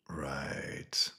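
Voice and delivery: unvoiced hushed reply; whisper. A thug in the dark, whispered reply. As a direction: breathe the word out in the dark.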